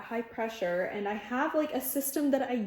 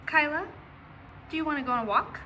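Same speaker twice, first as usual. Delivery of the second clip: higher, coaxing invite; dog talk; 5 semitones higher, faster than usual. Real, not acted. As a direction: tempt the dog with a walk. Asking the dog.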